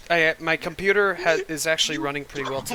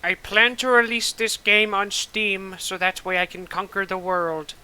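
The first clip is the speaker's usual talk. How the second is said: grandiose villain; 5 semitones higher than usual. Pretend. Pompous mock villain speech.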